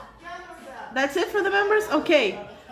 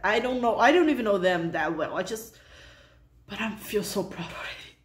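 ordinary talk, nothing marked

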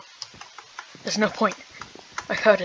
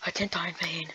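whispered, softer; mock solemn whisper; 9 dB quieter than usual. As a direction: whisper it like a noble last word. After dying in game.

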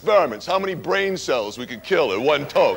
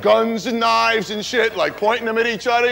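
ordinary talk

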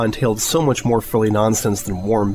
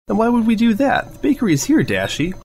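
much higher, bouncy and playful; teasing her girlfriend in a much higher bubbly voice; bubbly Pinkie voice; 12 semitones higher than usual. Acted. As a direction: squeak it up like an excited party pony.

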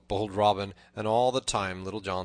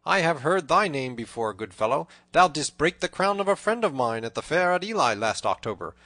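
loud, raised, lively declaiming; hearty proclamation; 5 semitones higher, 9 dB louder than usual. A narrator's reading. Robin reveals himself to the tanner, proclaiming loudly. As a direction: announce the names with a flourish.

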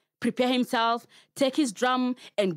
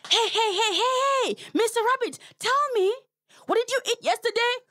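high, eager gossip voice calling out; nosy neighbour hailing the rabbit; gossipy forest animal; 14 semitones higher, faster than usual. Acted. A forest animal calling to Mister Rabbit, high and nosy.

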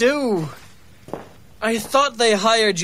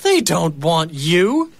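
normal talking voice

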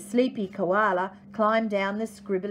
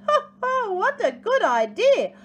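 high, loud storybook ho-ho; hearty acted laugh running into delighted words; 8 semitones higher, 6 dB louder than usual. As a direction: crow it out, high and loud, as a merry dingo. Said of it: "Dingo laughed".

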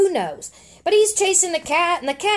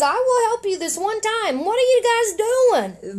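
higher, lightly voiced bird; bird character voice; 8 semitones higher than usual. Mom reading the comic to her kid, bird offers help, lifted voice. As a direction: read the bird's line in a lifted voice.

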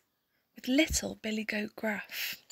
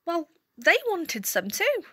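ordinary delivery

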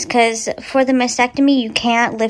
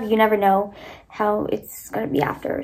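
ordinary delivery

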